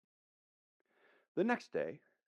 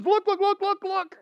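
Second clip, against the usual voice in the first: high, urgent rapid repeats; excited calling out; 7 dB louder than usual. Acted. John finally seeing Jesus, excitedly calling out. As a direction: urge everyone to look right now.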